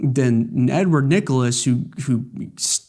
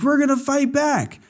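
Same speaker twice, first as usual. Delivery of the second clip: very high, defiant put-on; rally the crew cartoonishly; hammed-up defiant shout; mock battle cry; 12 semitones higher than usual.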